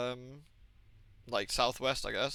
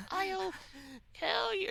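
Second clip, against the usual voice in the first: put-on very high-pitched little ghost girl; 5 dB quieter, much slower than usual. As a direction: squeak it out as a tiny ghost girl. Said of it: "This little girl ghost goes like".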